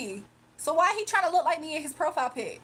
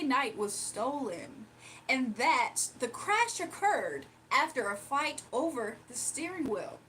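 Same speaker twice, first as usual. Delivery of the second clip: measured, even anchor read; steady newsroom delivery; news read; 2 semitones lower, 4 dB quieter, slower than usual. Acted. Reads a fake news script.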